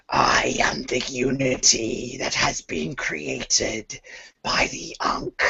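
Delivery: high whispered intoning; intoning skull. Acted. GM voices the undead skull to the party, eerie whispered intoning.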